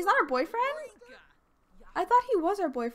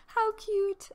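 "How cute" squealed much higher; 9 semitones higher than usual. Real, not acted. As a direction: gush it up high. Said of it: "How cute is he?".

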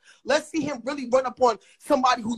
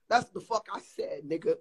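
ordinary delivery

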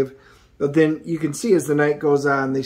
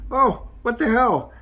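much higher, startled exclamation; surprised; 9 semitones higher than usual. Real, not acted. Reviewer reacting to the gory panel, much higher surprise. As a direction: yelp it out in shock.

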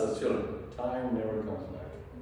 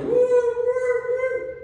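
very high siren wail; high, loud whooping alarm noise; alarm siren imitation; 8 dB louder, much slower than usual. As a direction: mimic a burglar alarm going off. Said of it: "the break alarm is like".

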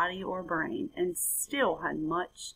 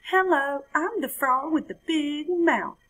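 high, louder jubilant hello; jubilant frog; 9 semitones higher, 5 dB louder than usual. Acted. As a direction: jump in with glee. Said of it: "with a jubilant jump".

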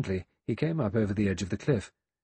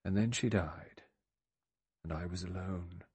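desolate; 6 dB quieter than usual. A narrator's reading. Old man recalls her death, desolate.

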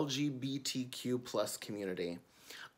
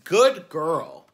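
put-on louder, firm little-girl correction; child correcting mama, louder; insistent child; 10 dB louder, slower than usual. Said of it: "I would say".

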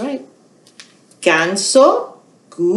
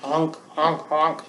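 goose honk imitation; 4 semitones lower, 8 dB quieter than usual. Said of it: "¿Qué sonido hace el ganso?".

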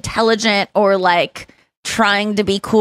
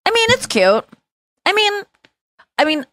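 put-on much higher, bubbly girlish take on her; upbeat young assistant impression; 11 semitones higher than usual. To a friend asking about a shirt, imitating her assistant's much higher upbeat voice. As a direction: pitch way up and gush like an upbeat young fan. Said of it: "Can you do an impression of her talking?".

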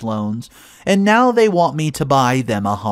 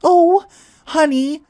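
much higher, louder, syrupy sweet coaxing; sickly sweet mother; 9 semitones higher, 3 dB louder than usual. Retold or imitated. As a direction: coax like an overly sweet mother. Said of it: "sounded as sweet as my boyfriend Mark".

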